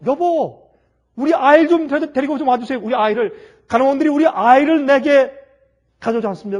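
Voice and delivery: loud, high desperate plea; pleading cry. Acted. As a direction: beg loudly for the baby. Wife begging husband in hospital.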